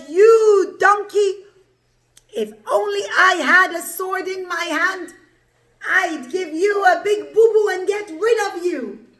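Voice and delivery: loud, high storybook scolding; angry threat. Acted. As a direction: threaten the donkey in mock fury. Bilam threatens his donkey.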